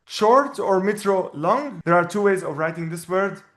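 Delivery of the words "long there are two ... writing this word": ordinary delivery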